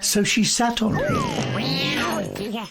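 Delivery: plain reading voice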